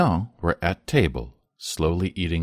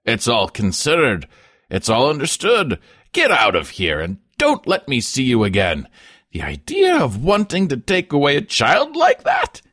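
loud, indignant dismissal rising in pitch; exasperated; 8 semitones higher, 6 dB louder than usual. A narrator's reading. Peasant woman sending the couple away, exasperated. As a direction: cut them off and throw them out, outraged. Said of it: "exasperated, cut him short".

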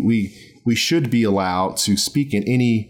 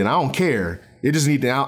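usual speaking voice

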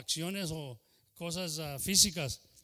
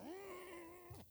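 strained grunt of effort; 14 semitones higher, 15 dB quieter than usual. Retold or imitated. Pushing the car, straining.

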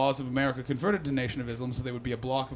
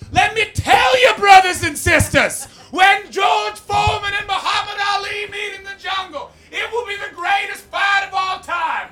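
very high, loud, slow preacher-promoter shout; slow, high, booming fight-promoter sermon; Don King impression; 6 dB louder, slower than usual. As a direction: boom like a hype-man promoter. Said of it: "he talks like this".

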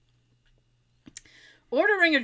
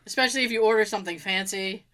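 ordinary talk

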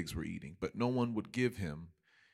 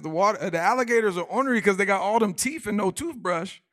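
much higher and louder mocking professor; professor repeating; 10 semitones higher, 7 dB louder than usual. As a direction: echo the silly answer back, incredulous. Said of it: "So the professor repeats".

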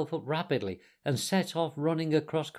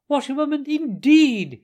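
loud, high, indignant outburst; reckless shout; 12 semitones higher, 10 dB louder than usual. A narrator's reading. Toad annoyed by the gentlemen's warning, shouting recklessly. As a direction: throw the name back at them, shouting. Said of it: "he shouted recklessly".

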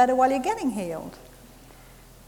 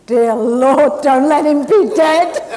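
loud, higher, slowed desperate plea; panicked prayer; 5 semitones higher, 11 dB louder, much slower than usual. Retold or imitated. Preacher privately begging God the man isn't dead, panicked loud plea. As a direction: cry it out like sudden panic.

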